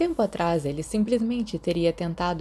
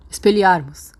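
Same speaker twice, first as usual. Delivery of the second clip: loud, sharp spell cast; shouted spell; 7 dB louder than usual. A narrator's reading. Remus disarming two bullies, shouting the spell. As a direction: fling the spell out at full voice. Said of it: "ele gritou".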